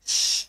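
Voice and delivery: श as a near-silent unvoiced sh; a soft long hiss of श. Shown as a demonstration. Teacher demonstrating sh, keeping it low near children. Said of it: "तो निकलेगा श".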